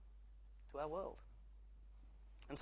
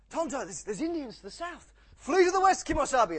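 urgent heroic shout, much higher and louder; Lone Ranger; 11 semitones higher, 14 dB louder than usual. Acted. Cowboy hero warns his companion of an ambush, mock-urgent shout. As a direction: call the alarm like a hammed-up cowboy hero. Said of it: "Lone Ranger goes".

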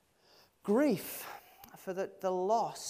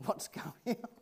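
normal talking voice

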